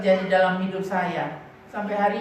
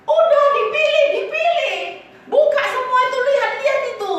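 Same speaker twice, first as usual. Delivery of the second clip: much higher, louder shopkeeper voice; shopkeeper urging; 12 semitones higher, 5 dB louder than usual. Retold or imitated. Seller urging the girls to pick clothes, high and loud. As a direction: play the pushy seller egging the kids on. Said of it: "yang jualan tuh bilang begini".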